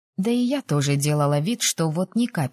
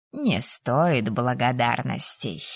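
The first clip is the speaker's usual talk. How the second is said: put-on low, slow elderly voice; old dean; 6 semitones lower, slower than usual. A narrator's reading. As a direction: dismiss the thanks slowly, deep in an old man's voice. Old dean answers thanks. (низко и медленно, голосом старичка)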